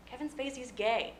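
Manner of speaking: ordinary talk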